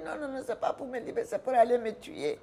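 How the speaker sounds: high, fast, insistent refusal; panicked no, quick and high; frantic protest. Retold or imitated. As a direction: pitch up and rush the protest. She protests against leaving.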